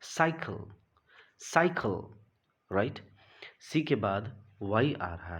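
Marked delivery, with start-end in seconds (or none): 0.1-2.0 cycle with an s, said high; a touch higher teacher demo of cycle; soft c in cycle. Shown as a demonstration. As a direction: model cycle twice, s sound up top. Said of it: "This is".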